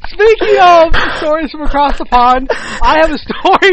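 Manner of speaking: high, loud and slow, cracking up mid-sentence; trying not to laugh. Real, not acted. Host introducing his story while still cracking up, loud high laughing voice. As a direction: fight back laughter and lose. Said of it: "I can't do it".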